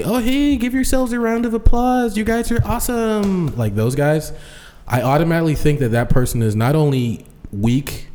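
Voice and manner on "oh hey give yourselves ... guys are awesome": much higher, sugary crowd-pumping emcee voice; mock an over-eager host hyping the crowd; squeaky fake-enthusiastic MC; cheesy hype emcee. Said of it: "those guys who, like, say".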